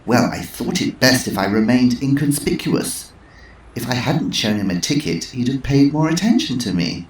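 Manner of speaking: put-on matter-of-fact old dear; Missus Twistleton. Twistleton voiced.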